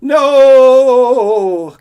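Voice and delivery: very high, very loud wailing no; high loud anguished howl. Acted. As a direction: howl in despair. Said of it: "Coyote howled".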